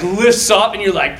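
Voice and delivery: usual speaking voice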